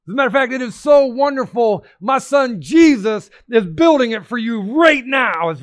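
rising excitement. Acted. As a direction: promise it like the best news ever. God's voice builds excitedly.

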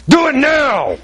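high-pitched, drawn-out mock bellow; God losing patience, in comic retelling; ironically "polite" command. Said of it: "God so politely intervened and said".

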